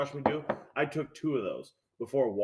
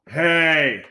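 yelled hey, pitched up; retold yell; 3 semitones higher, 9 dB louder than usual. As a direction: call out sharply from a distance. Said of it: "just yells at me from the alley".

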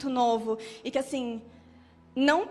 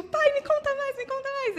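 voz bem aguda de criança pedindo ao pai; criancinha empolgada; 14 semitones higher than usual. Acted. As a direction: imite uma criancinha suplicando por mais. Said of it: "eu era uma criancinha". (voz de criancinha pidona)

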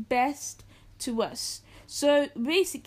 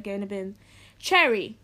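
ordinary delivery

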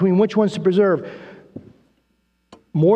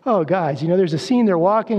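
ordinary talk, nothing marked

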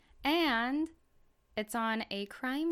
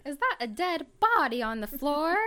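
high, loud gee-whiz detective; old-timey detective; 7 semitones higher, 5 dB louder than usual. Retold or imitated. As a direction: gasp it like a cheesy reenactment. Mocking cheesy reenactment detectives.

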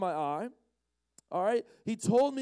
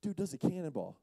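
understated; 6 dB quieter than usual. Real, not acted. Preacher telling of the man's dive, understated. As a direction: drop it in quietly, deadpan.

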